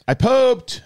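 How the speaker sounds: put-on high, loud little-girl announcement; dad mimicking his daughter's triumph; proud toddler. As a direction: shout it up high like a proud four-year-old. Four-year-old daughter announcing proudly.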